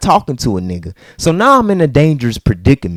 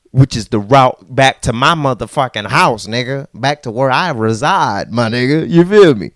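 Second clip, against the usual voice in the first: ordinary talk, nothing marked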